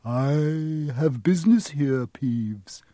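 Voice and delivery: slow, drawn-out ghostly croak. A narrator's reading. Harry posing as the Baron to Peeves, croaking. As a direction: croak it out slowly with authority. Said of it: "croaked Harry".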